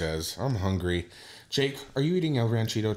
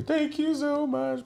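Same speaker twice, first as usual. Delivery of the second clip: squeaky thank-you; slower than usual. Retold or imitated. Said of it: "she texted me".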